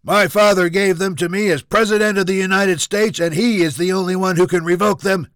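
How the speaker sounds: high, loud, entitled protest; angry barking. Acted. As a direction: shout down the captain indignantly. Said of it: "Junior barked".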